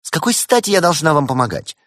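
high, loud indignant retort; affronted, high-pitched challenge; spiteful refusal. A narrator's reading. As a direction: throw the question back angrily. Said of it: "Валерия злобно фыркнула".

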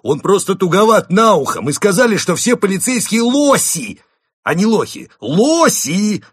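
high, loud frantic correction; shout. A narrator's reading. Second wolf explaining himself to police, shouting. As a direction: shout the explanation, insisting on ЛОСИ. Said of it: "закричал второй волк полицейским".

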